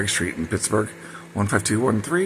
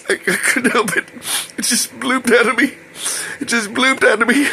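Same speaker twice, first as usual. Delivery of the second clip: high, louder voice of a mortified crier; imitated tearful embarrassment; 3 dB louder than usual. Embarrassed Oscar confessing his accident to his helper, imitated tearful high voice. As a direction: blurt it out high, as if crying with embarrassment. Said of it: "all of a sudden he starts crying".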